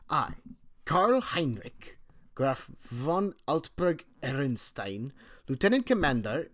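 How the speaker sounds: mock German accent. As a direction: announce yourself like a stiff German naval officer. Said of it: "I can't do this accent".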